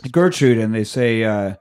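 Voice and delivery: ordinary talk, nothing marked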